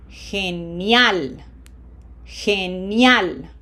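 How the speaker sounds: "Genial" with the last syllable stressed and drawn out; stress on the marked syllable. Shown as a demonstration. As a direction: say "Genial" in Spanish, landing firmly on "-al". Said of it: "emphasis on syllable number one".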